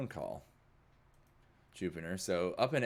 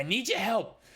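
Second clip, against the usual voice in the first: high, loud call for help; urgent shout; 5 dB louder than usual. Acted. Eddie wants help trashing the drugs, shouting urgently. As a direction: shout over your own banging around.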